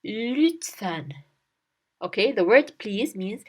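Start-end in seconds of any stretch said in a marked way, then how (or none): 0.0-1.1 "lütfen" with fronted, rounded ü; "lütfen" with a clear ü and open e; correct ü. Shown as a demonstration. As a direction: say "lütfen" as "lewt-fen", carefully.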